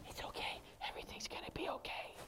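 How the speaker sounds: breathy whispered reassurance, mocking; mock gentle whisper. Preacher mocking the gentle whisper as soft whispering. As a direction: whisper it like a lullaby.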